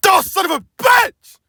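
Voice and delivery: high yelled curse; angry curse. Acted. James curses when his plan backfires. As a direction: spit out the curse.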